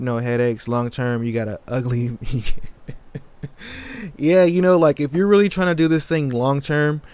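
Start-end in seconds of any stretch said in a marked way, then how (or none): 2.2-4.1 sudden high burst of laughter. Real, not acted. Laughs at viewer's comment. 4.2-7.0 laughing. Real, not acted. Still laughing at viewer's joke.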